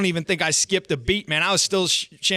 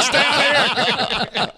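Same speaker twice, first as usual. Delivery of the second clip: slow, high, laughing through the words; a mock command lost to giggles; laughing mock order; 8 semitones higher, 4 dB louder, much slower than usual. Buddy ordering others to stay out, laughing high and slow.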